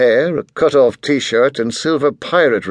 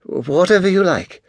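flustered waitress; 7 semitones higher than usual. A narrator's reading. Waitress voiced, far timbre.

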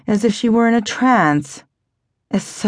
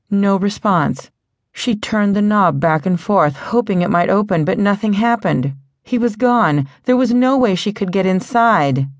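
ordinary delivery, nothing marked

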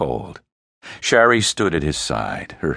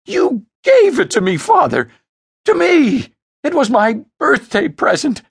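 shrill, much higher furious wail; screaming tantrum; 7 dB louder than usual. Acted. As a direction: stamp and scream like a spoiled child. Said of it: "stamped his feet".

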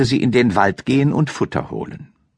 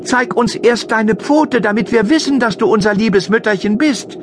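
put-on much higher, louder, defiant children's voice; defiant goat kids; 14 semitones higher, 4 dB louder than usual. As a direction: kids calling out boldly through the door. (hohe, trotzige Kinderstimme)

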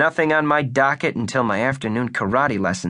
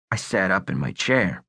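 usual speaking voice

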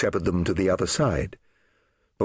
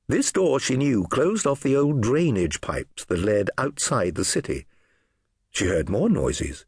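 ordinary delivery

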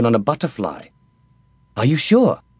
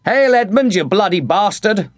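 much higher mocking hail shouted down; called over the wall; 9 semitones higher than usual. A narrator's reading. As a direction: jeer down at the bastard son. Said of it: "I called over the wall".

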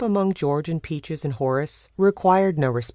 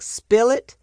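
mocking quote; 8 semitones higher, 4 dB louder than usual. Uncle mocking Sam's newsroom slang, scornful lilt. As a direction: Spill it repeated with a scornful rising lilt, a little louder. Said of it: "saying things like".

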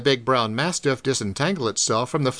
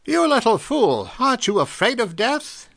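1 crying out; 8 semitones higher than usual. A narrator's reading. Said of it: "Doc cried".

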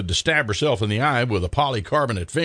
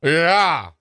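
yelp; 5 semitones higher, 4 dB louder than usual. Acted. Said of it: "yelped Freddie".

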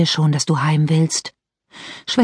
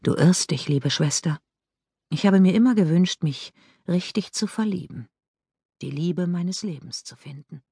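much softer, fading, wistful; 7 dB quieter than usual. Acted. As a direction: confide a sad longing quietly. Ghost speaks of lost love.

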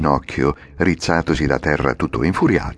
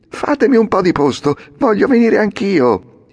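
eager to climb aboard; Pinocchio giving in; 5 dB louder than usual. Acted. Pinocchio gives in. (Pinocchio che cede, voce alta)

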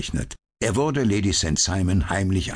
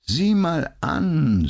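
drawn-out musing; 11 semitones higher, 4 dB louder, much slower than usual. A narrator's reading. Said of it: "paffte blaue Ringe".

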